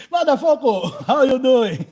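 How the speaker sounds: loud, laughing rough hello; loud laughing taunt; laughing mock greeting. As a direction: tease him with a loud greeting through laughter. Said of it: "continuing the teasing quote".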